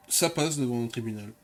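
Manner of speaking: usual speaking voice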